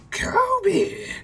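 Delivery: loud, very high drawn-out call; yelling out. Acted. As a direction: holler for the cabin boy in falsetto. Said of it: "she just yells out".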